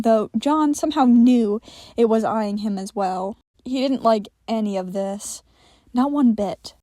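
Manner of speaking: ordinary delivery, nothing marked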